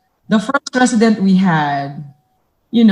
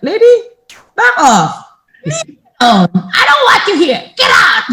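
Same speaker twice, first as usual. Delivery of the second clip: high, loud furious yelling; angry shout; 10 semitones higher, 4 dB louder than usual. Retold or imitated. Elderly resident yelling at new caregiver, high angry shouts. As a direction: drive her out of the room.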